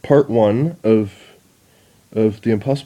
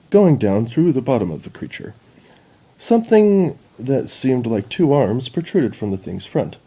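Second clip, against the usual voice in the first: ordinary delivery, nothing marked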